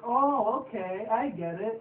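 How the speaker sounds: high "oh", then a slow dopey "okay, I get it"; hammed-up slow-witted pony; goofy pony realisation. Pony quote in recap.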